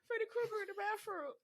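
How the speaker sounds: put-on scared child shouting.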